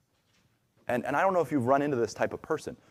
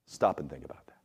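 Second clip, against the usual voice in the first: hushed whisper, much lower; 11 semitones lower, 4 dB quieter than usual. Retold or imitated. David urging his soul to pause, whispering. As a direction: breathe it out as a secret.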